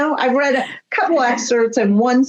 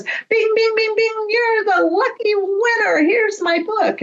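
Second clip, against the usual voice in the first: higher, mock game-show ding-ding winner call; game show host. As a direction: announce the prize like a cheesy host. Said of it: "And goes".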